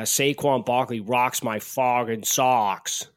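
slow, put-on Boston accent. Co-host offering a substitute for Bronx, mock Boston accent. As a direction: lay on a broad Boston accent. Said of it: "I could do a good Boston accent".

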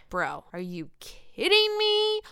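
high, drawn-out disbelief; incredulous. Retold or imitated. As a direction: stretch the question in disbelief. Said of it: "I was just like".

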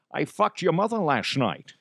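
put-on character voice; comic Connery voice; Connery DeHuff. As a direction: deliver it as the smug gentleman. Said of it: "now if I do Connor, he's like".

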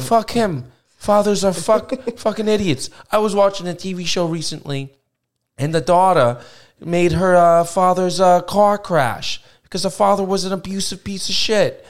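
Ben Soprano. Pretend. Host ranting about caller's father, in a mobster accent. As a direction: rant like a New Jersey wise guy. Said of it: "as Ben Soprano".

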